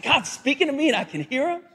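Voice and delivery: high, fast, giddy prophet; acted excitement, hurried and high; excited. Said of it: "but he's all excited".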